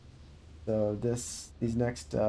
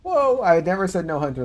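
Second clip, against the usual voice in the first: higher, louder, insisting he was misquoted; loud indignant denial; 7 semitones higher, 9 dB louder than usual. Real, not acted. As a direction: push back hard on the accusation. Protesting a misquote.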